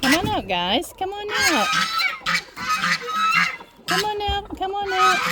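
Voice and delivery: high sing-song call to the hens. Real, not acted. Farmer coaxing chickens out of the coop, high sing-song call. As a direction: coax the birds out, high and bright.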